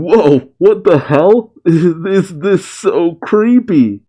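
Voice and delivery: high, drawn-out mock disbelief; startled exclamation. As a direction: act out creeped-out astonishment. Quoting Bakugo's startled reaction.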